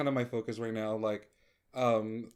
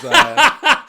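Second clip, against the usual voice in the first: laughing; 20 dB louder than usual. Real, not acted. Laughing at Bella Button mention.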